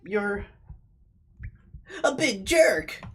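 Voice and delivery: put-on girlish crying accusation; crying little sister. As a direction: wail it like a hurt kid sister.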